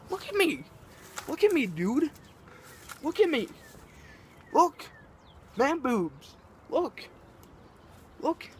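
exasperated, insistent high-pitched look at me; indignant look at me. Real, not acted. Ranter to his insulters, exasperated high insistent voice. Ranting about being called fat.

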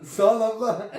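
laughing. Real, not acted. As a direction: laugh through the thanks. Thanking while laughing.

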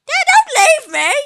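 put-on a boy afraid of being left behind; pleading child voice. Son begging his dad not to leave him, in a high kid voice.

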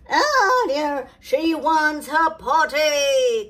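loud, slow, shouted alarm; the king shouting the news. Acted. The king raising the alarm, loud and slow. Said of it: "cried the king".

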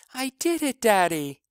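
put-on high, thrilled little girl; excited child. A narrator's reading. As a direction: burst with childlike triumph. Child rushes to father, triumphant.

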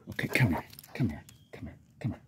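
soft whisper to a dog; talking to a dog. Real, not acted. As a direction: lean in and whisper gently.

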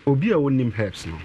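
usual speaking voice

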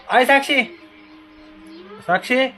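high-pitched call by name. Real, not acted. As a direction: call to someone in another room. Calling Sakshi again. (उंच आवाजात हाक मारत)

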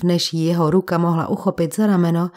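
ordinary delivery

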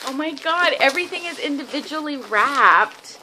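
thrilled reveal opening a present; excited. Real, not acted. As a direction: burst out with delight at the gift.

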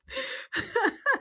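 a burst of high-pitched laughter; giggling high and breathy; high squealing laugh. Real, not acted. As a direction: squeal with delight at the joke. Laughing at own impression.

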